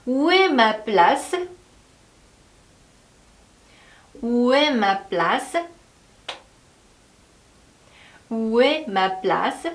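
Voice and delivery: very slow, word by word; slow drill. Shown as a demonstration. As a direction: model it slowly for repeating. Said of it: "한번 해볼까요?". (따라 하도록 아주 천천히)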